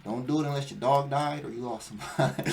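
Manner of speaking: normal talking voice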